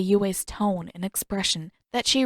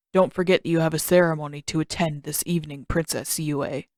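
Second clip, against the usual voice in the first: a lightly lowered voice for a stern palace guard; 4 semitones lower than usual. A narrator's reading. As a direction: read the guard a touch lower, stern and unbending.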